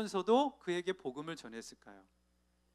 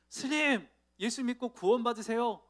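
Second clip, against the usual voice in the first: higher, louder call to the monk; a nervous but bold call; a lightly raised call after the monk; 5 semitones higher, 4 dB louder than usual. Retold or imitated. Driver finally calls out.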